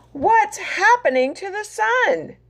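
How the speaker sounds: high startled question; alarmed rooster. Acted. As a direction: ask in rising panic. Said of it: "crowed Rooster".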